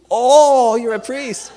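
loud, high mock surprise; a hammed-up gasp of wonder; surprised old woman.

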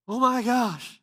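high, loud choked cry of shock; sobbing outburst. Retold or imitated. Overwhelmed young man bursting out crying at the realization. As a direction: break down into tears on it.

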